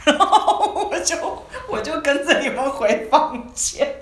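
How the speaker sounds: high, loud laughter running through the words; laughing. Real, not acted. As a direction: laugh your way through it, slowing down. Laughing while recalling the scene.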